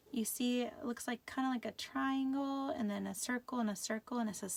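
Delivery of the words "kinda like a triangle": ordinary delivery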